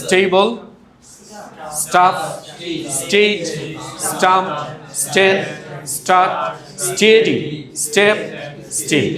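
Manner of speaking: স্টেবল, স্টাফ, স্টেজ with an airless ট after S; unaspirated "st" in স্টার্ট and স্টেডি; smooth T after S. Shown as a demonstration. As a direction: say each word apart, no puff in the T from স্টেবল to স্টিল. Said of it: "আগের মতো ঝাঁঝালো না".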